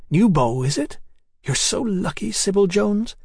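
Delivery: put-on high, lilting girlish envy; high-voiced gossip with a touch of envy; envious friend. A narrator's reading. Hetty envious of Sybil's new beau.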